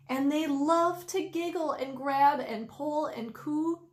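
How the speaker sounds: ordinary delivery, nothing marked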